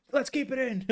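high, put-on director voice; squeaky imitation of a film crew boss; mock director. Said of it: "they're like".

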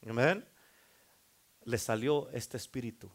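ordinary talk

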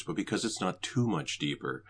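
ordinary talk, nothing marked

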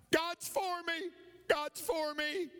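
high, slow declaration; acted shout. Boy shouting affirmations for the echo, high slow call. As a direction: call it out high, stretching the words. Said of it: "Shout out".

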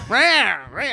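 high mock-argument yowls; high-pitched "rah" as cartoon bickering; imitated squabbling noise. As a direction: mimic a wordless back-and-forth row. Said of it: "gets into one of those just like".

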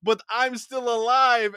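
loud, high cartoon vampire; Michael Morbius. Podcaster retells vampire protesting to Blade, loud mock-panicked Morbius. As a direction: cry out in a hammed-up high vampire voice. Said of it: "And Morbius is like".

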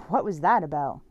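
loud puzzled question; asked out loud. A narrator's reading. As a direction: say it out loud into the room. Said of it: "I asked out loud".

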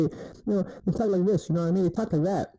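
mock high-pitched nervous guy voice; nervous feminine voice. Guy mimicking men nervous around women, high feminine voice. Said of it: "start talking with a feminine energy".

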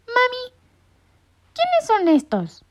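very high, slow little chick voice; a baby bird asking mom; chick's squawk. A narrator's reading. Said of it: "graznó el pollito". (pollito que grazna, muy agudo)

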